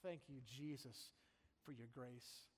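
fading to a breathy hush; mock pious sigh. Preacher imitating a relieved churchgoer, fading hush. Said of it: "to say".

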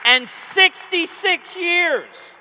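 loud, high emcee call over applause; rally the crowd, projecting big; rousing announcement. Real, not acted.

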